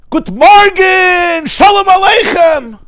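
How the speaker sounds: very high, slow, far-carrying shout; screamed greeting. Retold or imitated. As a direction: bellow good morning across the shul. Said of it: "he screamed".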